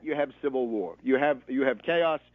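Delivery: normal talking voice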